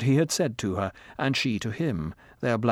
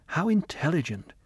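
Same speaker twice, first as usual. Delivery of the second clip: higher, awed exclamation; admiring exclamation; 6 semitones higher than usual. Acted. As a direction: marvel at him aloud. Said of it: "he thought".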